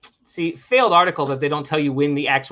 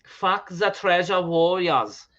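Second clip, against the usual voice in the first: mock German accent; 3 semitones higher, much slower than usual. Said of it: "You will be like".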